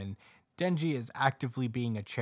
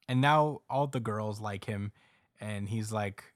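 ordinary talk, nothing marked

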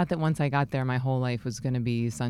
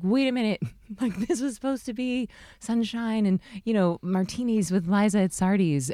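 high, bewildered younger self; hammed-up disbelief; mock confusion; 7 semitones higher than usual. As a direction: sound baffled, pitch lifted.

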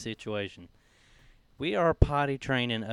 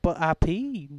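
put-on higher-pitched little-kid protest; imitated toddler; 6 semitones higher, 4 dB louder, slower than usual. Son answers his dad's scolding, imitated toddler protest. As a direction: mimic a small boy defending himself. Retold toddler defending himself to dad.

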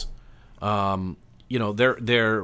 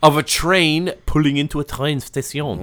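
hint of French on "train station"; French accent; 4 semitones higher, 4 dB louder than usual. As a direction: slide into mock French by the end. Resumes the French bit.

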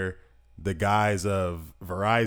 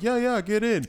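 eager welcome; 13 semitones higher than usual. Retold or imitated.

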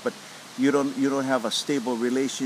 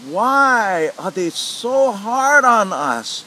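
exasperated thought; 8 semitones higher, 8 dB louder, much slower than usual. Retold or imitated.

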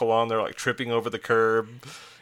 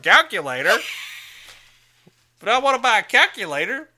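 put-on much higher, louder cranky old man; agitated old man voice; 8 dB louder than usual. Dad answering the calendar seller in an agitated old man voice. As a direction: play a confused, grouchy old man at the drive-thru. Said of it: "I even get like the agitated old man voice".

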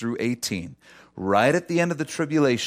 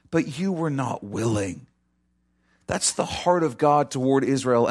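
ordinary delivery

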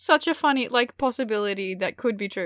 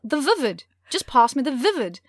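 insistent Kiwi roommate, higher and louder; New Zealand accent; 5 semitones higher, 4 dB louder than usual. Retold or imitated. Roommate repeating her request for a marker, insistent New Zealand accent. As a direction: press the request in imitated Kiwi vowels. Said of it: "the vowels were all wrong".

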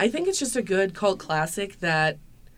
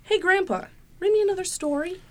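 put-on high, eager little boy; kid voice; 12 semitones higher than usual. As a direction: beg like a young grandson. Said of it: "you're gonna play grandson".

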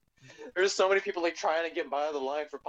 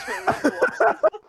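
words squeezed out through high laughter; laughing; 10 dB louder than usual. Real, not acted. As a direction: laugh right through the line. Laughing while telling the wiper story.

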